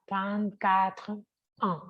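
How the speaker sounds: trente-quatre ans said slowly; slow model. Shown as a demonstration. Teacher modelling the age, slowly. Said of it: "Como es treinta y cuatro".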